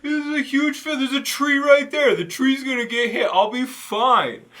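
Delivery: high, loud mock of a careless buddy; high mock voice of a friend shrugging off lightning. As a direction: whine it like a know-it-all who ignores danger. Mocking friend's dismissive reply.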